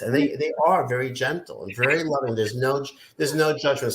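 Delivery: normal talking voice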